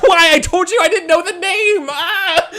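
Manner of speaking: high, loud hammed-up wail of protest; hurt screaming. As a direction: cry out as the torturer breaks your hand. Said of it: "He's, like, hurt".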